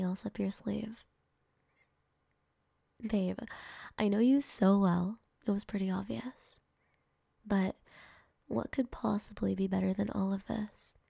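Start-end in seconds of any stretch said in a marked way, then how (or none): none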